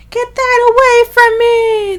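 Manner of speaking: high, loud, drawn-out acted scream. Host acting Carlo recoiling from bloody linen, screaming. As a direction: cry it out in horror, stretched long. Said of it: "visions of him screaming".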